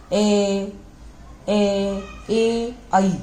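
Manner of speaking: ए repeated, then आई, open mouth without the tongue touching; vowel demo. Shown as a demonstration. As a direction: sound ए and आई freely, nothing touching. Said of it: "जीभ वगैरह कहीं touch नहीं हो रही".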